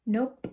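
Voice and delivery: "nope" with a popped final P; popping the P. Shown as a demonstration. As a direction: let the P burst at the end of "nope". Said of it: "I know you can pop the P".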